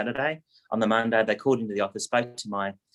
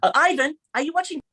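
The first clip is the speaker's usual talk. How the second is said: much higher, louder calling voice; mum yelling out; 5 dB louder than usual. Retold or imitated. Mother calls out to her son in another room, much higher and louder. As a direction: call out across the house to the son. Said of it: "she yelled out".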